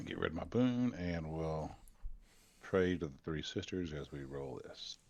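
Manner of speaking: ordinary talk, nothing marked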